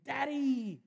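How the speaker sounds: high, loud happy shout; excited toddler call. Retold or imitated. Toddler greeting dad coming home, excited high call.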